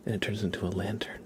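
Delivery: quick unvoiced whisper. Real, not acted. Streamer explaining lantern books to chat, whispering fast. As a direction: rush it softly on the breath.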